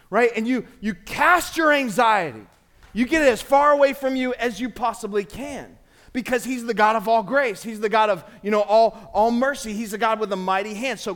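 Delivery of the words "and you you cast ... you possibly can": loud and emphatic, pushing hard; urgent. Shown as a demonstration. Peter urging the church to fling it away, loud and earnest. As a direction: hurl the words out like a net. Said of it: "I think he said".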